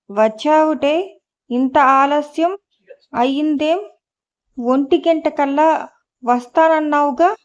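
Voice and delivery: ordinary delivery, nothing marked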